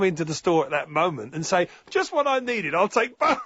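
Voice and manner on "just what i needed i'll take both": high-pitched delighted customer, acted out; mock eager shopper, pitched well up; one-legged actor. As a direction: deliver the punchline high and pleased. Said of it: "Vivian has paid a one-legged actor".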